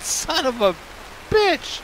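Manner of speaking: high, strained swearing with a pause before the last word; frustrated curse. Real, not acted. Cursing after death in game.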